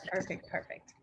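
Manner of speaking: perfect, perfect fading into a soft unvoiced murmur; trailing off. Real, not acted. Host trailing off to herself after the connection check, murmured. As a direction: let the repeated perfect drop away to almost nothing.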